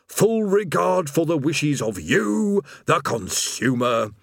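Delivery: slow, stoic recital of a service promise; stoic script reading. Acted. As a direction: plod through the script without feeling. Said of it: "the demon continued stoically".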